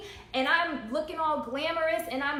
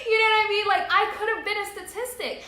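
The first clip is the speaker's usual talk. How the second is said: laughing, higher and louder; 8 semitones higher, 7 dB louder, faster than usual. Real, not acted. Telling viewers about her past while laughing. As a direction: laugh through the words, still making the point.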